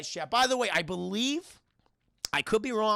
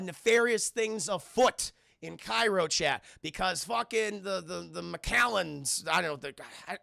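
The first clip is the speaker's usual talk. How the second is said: ordinary talk, nothing marked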